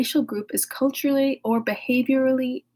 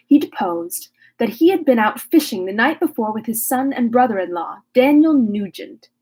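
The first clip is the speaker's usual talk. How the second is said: louder, formal book reading; reading aloud; 6 dB louder than usual. Acted. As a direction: read the chapter to the class. Reads the chapter aloud.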